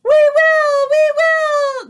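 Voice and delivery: high, loud, grinding chorus of witches; shrill witches answering as one; drilling witch chorus. A narrator's reading. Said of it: "their dreadful voices were like a chorus of dentists' drills".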